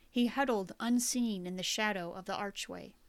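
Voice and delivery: ordinary delivery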